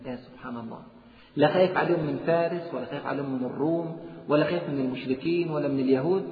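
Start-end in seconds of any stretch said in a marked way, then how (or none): none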